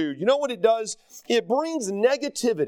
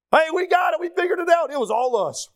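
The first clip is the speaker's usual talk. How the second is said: mock boastful shout; 7 semitones higher than usual. Pastor mocking prideful churchgoers with a boastful shout. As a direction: shout it like a proud know-it-all.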